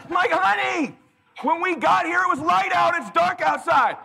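loud, high, slow exasperated rant. Retold or imitated. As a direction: rant at her to order. Husband venting at slow order.

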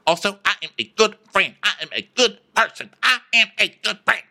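high, fast staccato protest; mock earnest insistence. Sutton defending herself to Diana, high insistent protest. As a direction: insist quickly, pitched high.

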